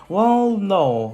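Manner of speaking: quick defensive correction; defensive well no. Retold or imitated. Friend answers surprised question.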